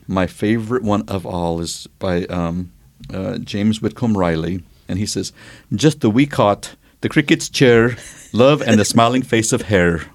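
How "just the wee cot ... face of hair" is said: lilting Irish accent reading a poem. Shown as a demonstration. Reading a poem aloud to a friend in an Irish accent. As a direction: recite the verse in a light Irish accent. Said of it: "Shall I keep the accent or lose it?".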